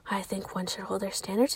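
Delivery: soft unvoiced whisper; hushed aside. Real, not acted. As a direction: say it in a hush. Whispered mock-prim line.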